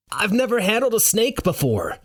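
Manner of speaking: high-pitched panicked protest; fearful yell back. A narrator's reading. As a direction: yell back in fear without full volume. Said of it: "I yell back, frozen in fear".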